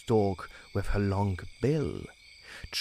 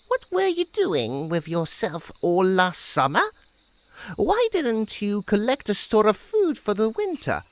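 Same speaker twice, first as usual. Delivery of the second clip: higher, louder reproachful voice; scolding ants; 9 semitones higher, 6 dB louder than usual. Acted. Ants scolding the grasshopper for wasting summer, high reproachful. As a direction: lecture the lazy one.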